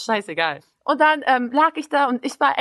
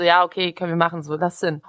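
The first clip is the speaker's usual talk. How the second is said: bored; 6 semitones lower than usual. Retold or imitated. Lying on lounger, bored reply.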